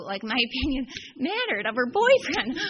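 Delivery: laughing. Real, not acted. Laughing at the memory.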